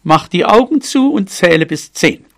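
loud and high, starting with a burst; loud cat reply. A narrator's reading. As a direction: call it out as the sly cat. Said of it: "Und laut sagte sie".

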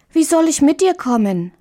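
put-on a small girl asking anxiously; little girl Marie. A narrator's reading.

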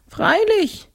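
very high, tearful-sounding spook; whiny ghost. A narrator's reading. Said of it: "sagte das Grafengespenst weinerlich".

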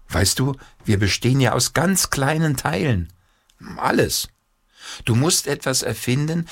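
high, keen explaining; father caught up in inventing; imaginative father. Acted. Father develops fanciful idea.

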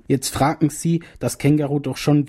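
ordinary delivery

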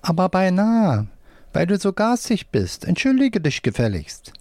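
high-pitched puppet telling-off; scolding hand puppet. A narrator's reading. Right hand demands an apology from the left, scolding puppet voice. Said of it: "Wolfram mit der rechten Hand".

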